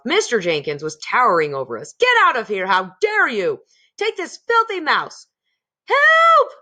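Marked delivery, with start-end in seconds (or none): 2.0-5.3 loud, higher furious roar. A narrator's reading. Mr Jenkins towering over them, furious and loud. 5.9-6.5 very high, loud cry for help; terrified cry. A narrator's reading. Mrs Jenkins terrified of the mouse, screaming for help. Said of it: "cried Missus Jenkins".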